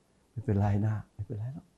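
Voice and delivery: slow, soft and kind reassurance; soft forgiving. Shown as a demonstration. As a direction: say it gently, letting it go. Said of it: "เสียงมันต่างกัน". (พูดเบาๆ ช้าๆ อย่างให้อภัย)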